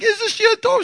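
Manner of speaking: high-pitched cooing delight; adoring gush. Retold or imitated. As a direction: coo over a newborn. Gushing over his newborn.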